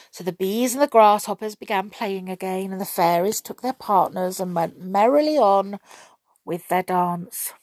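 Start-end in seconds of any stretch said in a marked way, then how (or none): none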